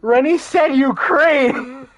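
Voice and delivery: high, loud, slow delighted exclaim; excited. Real, not acted. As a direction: announce it with glee. Reading funny chat comment.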